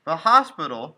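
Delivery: sudden loud shocked cry. Acted. Son learns his mother is in hospital, loud shock. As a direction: blurt it out in disbelief.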